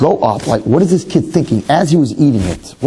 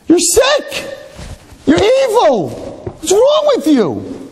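much higher, slow wounded cry; mock outrage; slower than usual. Rabbi replaying his shocked cry at the boy, high and slow. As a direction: wail it like you've been betrayed. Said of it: "He says".

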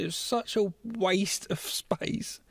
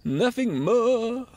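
slow, higher swooping croon; hammed-up Goblin King; 4 semitones higher, 3 dB louder, much slower than usual. Goblin King presents crystal to Sarah, grand croon. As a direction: draw it out theatrically.

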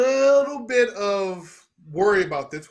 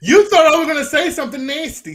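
excited; 7 semitones higher, 8 dB louder, faster than usual. Real, not acted. Teasing retort, loud and fast.

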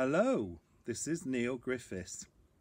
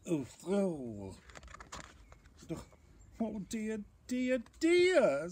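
high wail building louder; sighed dismay; 10 semitones higher, 5 dB louder than usual. A narrator's reading. Bear loses his blueberries to sneezing, high mounting dismay. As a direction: lament in a high storybook voice, building. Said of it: "sighed Bear".

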